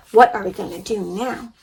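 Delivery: normal talking voice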